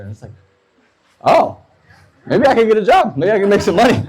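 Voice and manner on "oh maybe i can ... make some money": high-pitched, hopeful realization; light up at a sudden idea, pitched high; dawning realization. Retold or imitated. Realizing he could teach English too.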